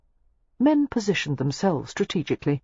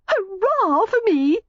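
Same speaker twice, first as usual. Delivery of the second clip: very high, slow, louder cheer; gleeful cry; 4 dB louder, slower than usual. A narrator's reading. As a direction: crow in triumph. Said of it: "she cried".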